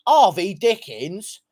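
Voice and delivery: slow, drawn-out British parody of the name; mock British accent. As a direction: say the name like a caricature Brit. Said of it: "Sorry about my British accent".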